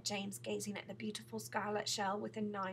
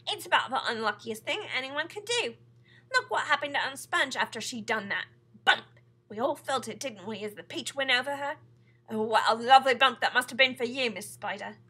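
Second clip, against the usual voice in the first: very loud, higher, excited rant; shouting; 3 semitones higher, 13 dB louder than usual. A narrator's reading. Centipede gloats over Aunt Sponge to the others, shouting. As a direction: boisterously relish every bump. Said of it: "shouted the centipede".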